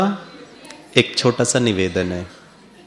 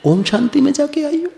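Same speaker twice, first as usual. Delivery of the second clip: much higher mocking child's jab; a child mocking his mother, voice pitched way up; sarcastic child. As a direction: tease mom like a cheeky kid. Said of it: "तो बच्चा mummy को".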